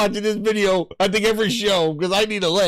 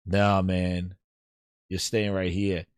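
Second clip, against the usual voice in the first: low, quiet, firm Phil; firm refusal; 10 semitones lower, 4 dB quieter, slower than usual. Retold or imitated. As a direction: lay it down low and steady. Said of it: "And then Phil's like".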